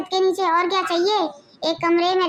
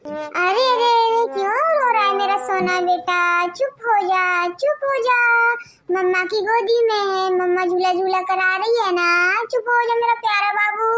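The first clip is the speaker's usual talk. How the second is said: put-on soothing baby talk; 2 semitones higher than usual. Mother soothing crying baby. (माँ का ऊँची सुरीली आवाज़ में बच्चे को चुप कराना)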